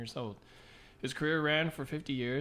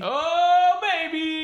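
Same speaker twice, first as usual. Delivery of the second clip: high, loud hammed-up whoop; hyped-up cheer; 10 dB louder, much slower than usual. Co-host greets the Friday thirteenth segment, hyped-up shout. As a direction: belt it out in mock hype. Mock hype as game segment starts.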